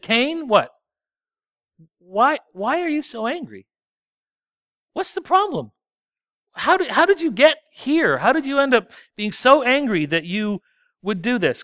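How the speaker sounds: God asking Cain why he's angry, questioning gently. Acted. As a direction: ask Cain like a concerned father.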